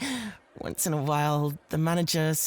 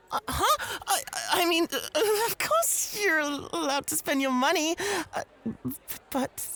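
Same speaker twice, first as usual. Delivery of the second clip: much higher panicky babbling; flustered; 10 semitones higher than usual. Acted. Host flustered as rival takes the guest's order. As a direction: scramble to keep paying for them. Flustered, pitch rising high.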